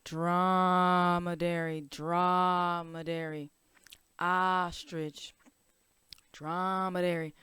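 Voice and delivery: "Dromedary" drawn out slowly, syllable by syllable; slow sounding out. Shown as a demonstration. Teacher sounding out the word for the class. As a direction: stretch "dromedary" so the kids hear each sound. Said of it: "what about dromedary".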